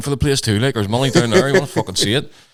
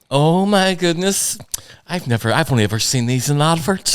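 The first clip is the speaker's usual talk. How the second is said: higher, stretched-out voice of a wowed mum; mock-delighted elderly woman; 7 semitones higher than usual. Friend's ma marvelling at the chocolates he brought, mock delight. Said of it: "And she was like".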